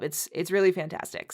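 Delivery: ordinary talk, nothing marked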